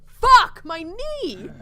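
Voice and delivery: high, loud cry of pain; pained yell. Acted. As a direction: yell as the knee is kicked. Kicked in the knee.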